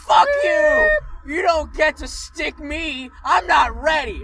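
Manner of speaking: screaming at the phlebotomist, full force; furious shout. Retold or imitated. Patient screams at the phlebotomist in panic, full-throated shout. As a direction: yell it, panicked and furious, yanking your hand away.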